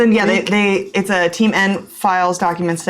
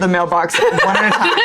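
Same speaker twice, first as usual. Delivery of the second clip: usual speaking voice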